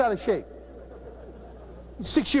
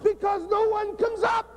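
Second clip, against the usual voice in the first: heated rant; 7 semitones higher than usual. Real, not acted. Coach ranting at the audience.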